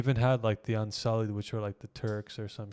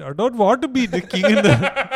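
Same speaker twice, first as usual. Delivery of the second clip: high, loud, laughing mock Indian accent; 10 semitones higher, 14 dB louder than usual. Joking to friend: Jon Snow as Indian, hammed-up Indian accent. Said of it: "But if he was Indian".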